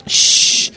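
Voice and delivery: loud hushing hiss; sharp shush. Real, not acted. Speaker quieting the room to resume, firm shush. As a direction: shush the crowd firmly.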